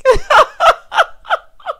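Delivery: loud, high cackle; real, uncontrollable high-pitched laughter; laughing. Real, not acted. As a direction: crack up in a big high laugh. Cracks up at the rhyme.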